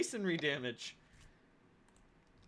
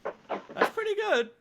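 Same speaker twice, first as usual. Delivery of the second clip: impressed; 5 dB louder, slower than usual. Real, not acted. Impressed at the bonus.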